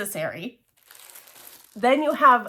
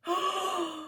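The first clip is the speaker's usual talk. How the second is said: gasp; 8 semitones higher, 9 dB quieter than usual. Real, not acted. Revealing the fabric.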